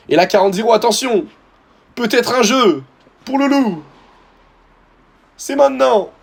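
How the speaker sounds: like a commentator sensing the moment; eager high hype for the game; rising excitement. Real, not acted. Hyping his first game.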